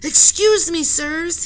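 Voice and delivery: high, very loud call for attention; polite shout. Acted. Henry shouts to the captain and professor, high and loud. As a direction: shout over the argument. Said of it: "he shouted".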